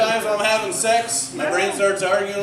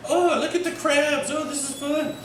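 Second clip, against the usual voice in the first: put-on higher, childlike delight; happy little kid; 6 semitones higher than usual. Nine-year-old playing in the water, high and delighted.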